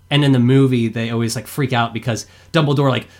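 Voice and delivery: normal talking voice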